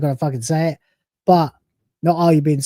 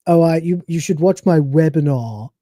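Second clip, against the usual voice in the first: pompous webinar host. Pretend. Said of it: "makes you sound like a pompous".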